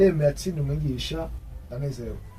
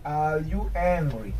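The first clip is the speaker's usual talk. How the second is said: "Are you angry" drawn out, falling at the end; slow, falling question; much slower than usual. Shown as a demonstration. Teacher modelling a question for learners, "Are you angry" slow and falling. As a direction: stretch "Are you angry" slowly and let it drop. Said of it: "here there is a question mark".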